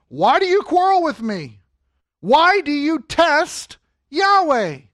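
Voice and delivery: high, loud, slowing rebuke; Moses rebuking. Acted. Moses confronting the quarrelling people, loud, high and slowing. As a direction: press the people hard with both questions.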